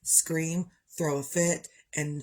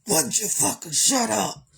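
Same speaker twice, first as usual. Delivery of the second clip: loud angry snap, pitch up; fed-up yell at the family; snapping; 5 semitones higher, 5 dB louder than usual. Retold or imitated. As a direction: shout it at them, fed up. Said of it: "that's when I'm like".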